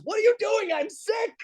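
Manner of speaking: high mock shout; Andy Kindler impression. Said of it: "He goes".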